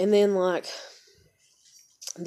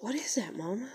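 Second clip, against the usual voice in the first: softly imitated daughter, slightly higher; hushed child's question; 4 semitones higher, 8 dB quieter than usual. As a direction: quote a child asking mom, hushed and higher. Voicing daughter seeing the photo.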